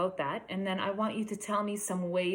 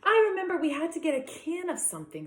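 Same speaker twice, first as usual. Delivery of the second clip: put-on higher, quick little boy's voice; child's voice; 9 semitones higher, 4 dB louder, faster than usual. Josh tries to recall the list, high and quick. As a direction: rush it like an eager little boy. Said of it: "said Josh".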